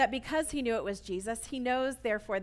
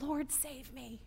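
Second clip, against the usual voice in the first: crying out for help; 6 dB quieter, slower than usual. Retold or imitated. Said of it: "he cries out".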